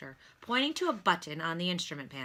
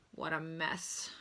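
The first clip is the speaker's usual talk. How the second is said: soft, slow dismayed groan; weary soft groan; 8 dB quieter, slower than usual. A narrator's reading. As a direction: sigh it out over the wreck. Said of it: "groaned the doctor".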